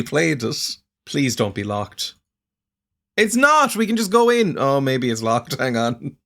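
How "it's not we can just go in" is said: excited. Real, not acted. Door turns out unlocked.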